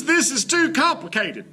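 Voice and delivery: high, exasperated exclamation of a struggling dad; desperate exclaim. Acted. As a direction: cry out in frustration at the chopsticks. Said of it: "Dad struggles desperately and exclaims".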